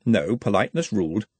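ordinary delivery

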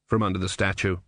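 usual speaking voice

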